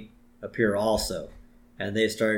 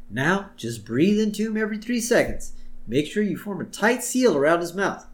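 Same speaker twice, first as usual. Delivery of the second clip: high, put-on coaching voice; imitated chipper instructions, pitched up; mock helpful instructor; 7 semitones higher than usual. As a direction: coach a child through first aid. Ned instructing a kid, acted.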